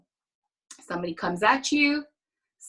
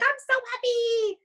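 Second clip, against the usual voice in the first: much higher, mock-chirpy fake happiness; mock cheery; 11 semitones higher than usual. Mocking fake-happy wake-up voice to the ladies, much higher chirp. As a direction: parody forced morning cheer. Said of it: "be like".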